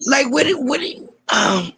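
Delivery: a little wheezy, healing voice. Real, not acted. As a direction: talk through a wheezy throat. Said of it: "my voice is trying to heal, so I'm kinda sound like a little wheezy".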